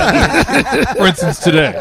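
drawn out through a laugh. Real, not acted.

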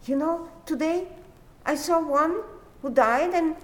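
high, quick, fretful retelling; hammed-up nervous storyteller, high and quick; praying man's anxious tale. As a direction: rush out the news of the dead man, pitched high.

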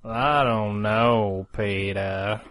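very slow, drawled cartoon voice; Family Guy character impression. Shown as a demonstration. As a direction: draw each word right out like a cartoon sidekick.

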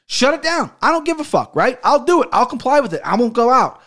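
high, forceful, heated outburst; really riled and insistent; worked up. Real, not acted. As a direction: fire off defiant, exasperated short bursts. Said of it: "I got a little worked up there".

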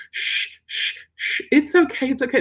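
hushing then high comforting okay; soothing owner. Retold or imitated. Owner at the workshop hushing the whining dog, high soothing. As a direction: shush and soothe like an anxious owner. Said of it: "They're like".

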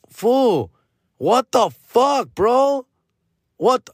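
mock outraged disbelief; incredulous. Comedian reacting to friend forgetting shirt, high and incredulous.

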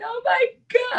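high, breaking mock sob on the words; retold crying. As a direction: replay the moment you cried on the phone. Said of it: "Like, I was crying on the phone".

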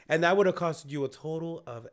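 normal talking voice

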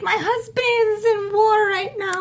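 high, drawn-out mock character voice; hammed-up movie woman, stretching every word out high; movie-character accent. Co-host mimics the married woman Baxter took home, exaggerated movie-character accent. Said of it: "that accent, that character".

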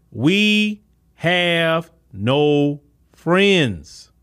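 each word drawn out, very slow and weighty; slow solemn quote. Acted. Commentator quoting Doctor John Henry Clarke, very slow and solemn. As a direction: let it land like a proverb, slowly.

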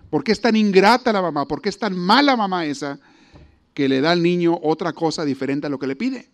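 normal talking voice

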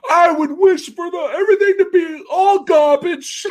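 high squealing goblin shout; high goblin yell; pig goblin voice. Retold or imitated. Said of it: "the pig goblin's all like".

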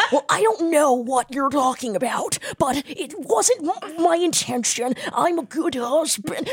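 slow, labored mock American accent on the R's; imitated American accent. Husband defending himself in a therapy scene, imitated bad American accent. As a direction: stumble through a bad American accent, drawn out. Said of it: "it's the R's".

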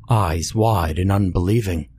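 usual speaking voice

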